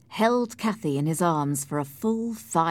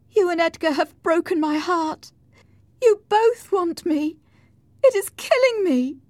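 high, loud anguish; high-pitched, emotional lament; dying lover's reproach; 13 semitones higher, 7 dB louder than usual. Acted. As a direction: accuse him in a rising wail. Dying woman reproaches her lover.

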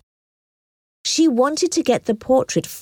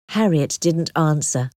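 plain reading voice

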